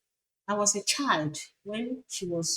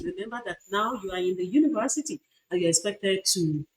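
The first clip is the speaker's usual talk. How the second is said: ordinary delivery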